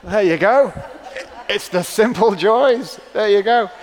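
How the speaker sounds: preacher laughing after the viral clip; laughing along. Real, not acted.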